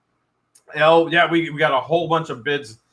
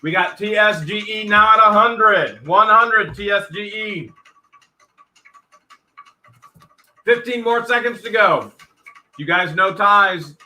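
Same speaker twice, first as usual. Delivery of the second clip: quick, raised auction calling; auctioneer calling bids; 6 semitones higher, 4 dB louder than usual. Real, not acted. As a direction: call out the bids like a live auction.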